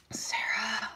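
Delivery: breathy unvoiced whisper of her name; hushed whisper. Real, not acted.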